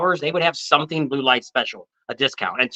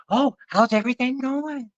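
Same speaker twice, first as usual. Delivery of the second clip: high, slow and syrupy; a sugary sales-call greeting, pitched up and drawn out; mock sweet sales girl; 9 semitones higher, 3 dB quieter than usual. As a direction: sweet-talk like a phone saleswoman. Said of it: "the first thing they'd always say is".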